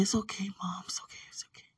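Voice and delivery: hushed, breath-soft soothing, fading away; gentle reassurance. Retold or imitated. Daughter telling Mom the lost picture is fine, softly reassuring. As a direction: comfort tenderly in a near whisper. Retells soothing her sorry mother.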